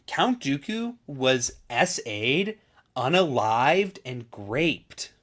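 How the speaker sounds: slow, exaggerated censored-speak; mock TikTok narrator. Mocking demonetization-dodging creators, slow exaggerated delivery. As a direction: stretch each euphemism in mockery.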